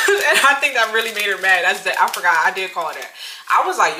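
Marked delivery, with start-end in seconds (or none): none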